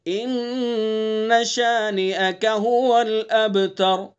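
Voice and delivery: slow chanted tajweed recitation; measured recitation; Quran recitation. Shown as a demonstration. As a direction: recite slowly and melodically. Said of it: "اب اس میں کیا غلطی کرتے ہیں".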